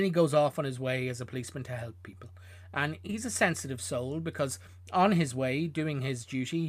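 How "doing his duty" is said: ordinary talk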